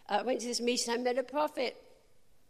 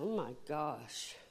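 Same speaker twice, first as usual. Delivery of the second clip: dismayed murmur; 7 semitones lower, 10 dB quieter, slower than usual. Retold or imitated. Dismay facing hostile men.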